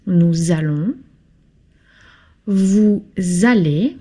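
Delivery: ordinary delivery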